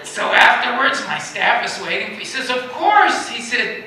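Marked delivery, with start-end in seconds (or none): 2.5-3.3 high, louder, delighted yes; eager acceptance. Retold or imitated. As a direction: toss it off much higher, gladly. Minister's eager reply.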